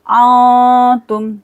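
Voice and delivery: आ held as a long nasal hum before तुम; nasalised, stretched आ, then short तुम; ghunna, ikhfa. Shown as a demonstration. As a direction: hum through the hidden n between आ and तुम. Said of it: "नून सर गुन्ना करेंगे".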